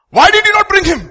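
high, loud furious demand from the priests; angry demand. Acted. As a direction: bark it at the officers as the chief priest. Priests demand why officers failed.

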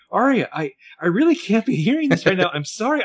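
near-tears panic, pitched high; overwhelmed. Retold or imitated. Spencer overwhelmed.

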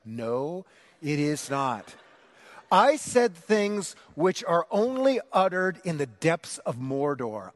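ordinary delivery

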